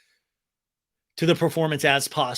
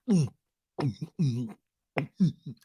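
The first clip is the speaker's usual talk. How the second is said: quick run of throaty gulps; imitated gulping. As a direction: imitate someone noisily gulping a drink. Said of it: "did you guys hear the gulp?".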